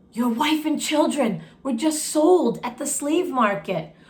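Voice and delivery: ordinary delivery, nothing marked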